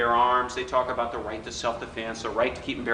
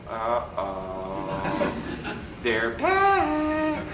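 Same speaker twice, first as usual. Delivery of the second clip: scared guy; 3 semitones lower, much slower than usual. Retold or imitated. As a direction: gasp it out like a man caught off guard. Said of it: "this guy went".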